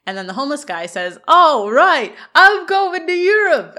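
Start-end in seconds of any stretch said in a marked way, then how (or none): 1.3-3.8 loud, high, drawn-out mock celebration; elated cheer. Homeless man handed a free bus ticket, hammed-up elation. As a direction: whoop it out slowly in imitation.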